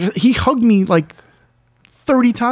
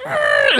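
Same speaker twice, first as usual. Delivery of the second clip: very high squealing tyre noise; high-pitched imitated screech; car screech imitation.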